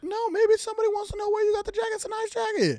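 quick, very high mock-innocent voice; high-pitched protest. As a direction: protest in a squeaky, put-on high voice. Acted quarrel over a jacket.

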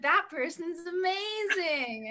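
high, slow admiring gush; gushing praise. Retold or imitated. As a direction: rave about someone, drawn out. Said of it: "the whole world's like".

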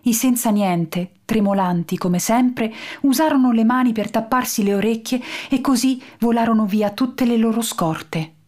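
ordinary delivery, nothing marked

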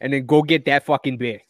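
mock thick Boston accent, dropped R's. Pitching a beer sponsor, hammed-up Boston accent. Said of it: "Massachusetts and Rhode Island, that same accent".

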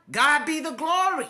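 usual speaking voice

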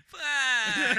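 loud, high, drawn-out mimicry; mock the drunk voice, big and stretched; exaggerated copy of a drunken wail; imitated drunk voice. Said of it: "start where you're just like".

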